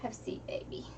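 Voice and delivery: hushed, gentle reassurance; soft soothing whisper. Real, not acted. Mom soothing hurt son.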